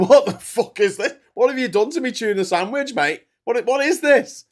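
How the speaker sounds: loud, high indignant yelling at a sandwich; outraged disbelief. Retold or imitated. Retelling his reaction to Australian mayonnaise, loud and appalled. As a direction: blast it like your lunch was ruined. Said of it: "I was like".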